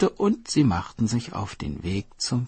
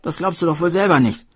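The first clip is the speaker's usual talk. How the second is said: taunting, louder and fast; mocking hare; 8 semitones higher, 7 dB louder than usual. Hare mocking the turtle for being fooled, gleeful. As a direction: laugh at the fool who believed it.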